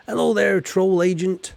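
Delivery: put-on hearty, loud greeting pitched high in a farmer accent. Farmer greets the troll agent with a loud, high farmer-accent hello. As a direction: call out a big farmer-accent welcome.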